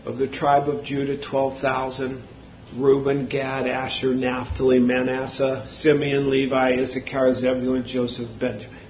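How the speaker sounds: low, soft recital of a list; scripture reading. Acted. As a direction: read the verse out quietly and evenly. Reads scripture aloud.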